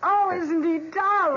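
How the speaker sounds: high, loud gushing. Acted. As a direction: gush over the puppy. Gushing over puppy.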